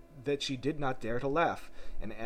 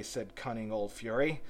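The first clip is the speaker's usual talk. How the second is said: usual speaking voice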